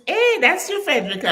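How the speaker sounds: high, pleased surprise at a friend; surprised greeting. Real, not acted. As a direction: call out to her delighted.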